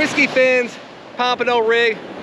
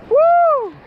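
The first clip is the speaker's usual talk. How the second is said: excited whoop; 5 dB louder than usual. Real, not acted. Celebrating the pompano.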